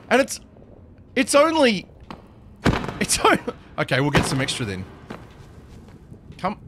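normal talking voice

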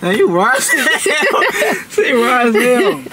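slow words broken by laughter; laughing. Real, not acted. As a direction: laugh as you tease. Friends teasing, laughing.

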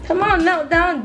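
usual speaking voice

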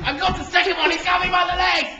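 shouting. Real, not acted. Wrestling, calling out play-by-play.

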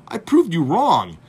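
I proved you wrong, hammered out. Retold or imitated. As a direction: punch each word slowly and loudly. Heated retort.

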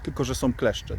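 ordinary delivery

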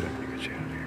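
low urgent whisper. Acted. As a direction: whisper the rescue so guards can't hear. Rescuing captive captain quietly.

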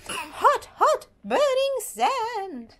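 high yelps of hot feet; hot sand yelp. Acted. Child hopping over burning sand, high yelps.

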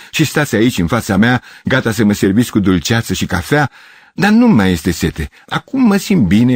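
high, theatrical effusion; exuberant exclaiming. A narrator's reading. Gavrilescu telling the girls how content he is, exuberant exclaiming. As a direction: declaim it with arms flung up. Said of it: "exclamă Gavrilescu, ridicând din nou brațele".

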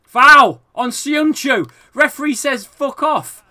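loud, high-pitched outrage; indignant protest. Real, not acted. Gamer protesting the referee over a foul, indignant. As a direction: cry foul in real indignation.

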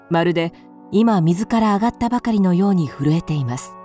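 normal talking voice

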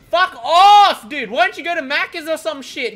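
high, worked-up shout; exasperated yelling. Real, not acted. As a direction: yell at them to back away. Yelling at player on generator.

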